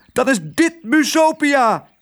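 very high, drawn-out mouse cheer; slow, high cry of joy; jubilant cheer. A narrator's reading. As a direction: cheer it in squeaky triumph. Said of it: "juichte Lodewijk".

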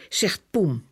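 ordinary delivery, nothing marked

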